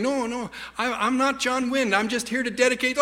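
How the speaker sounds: meek high-voiced objection, acted; retold protest. Retold protest drowned by villagers.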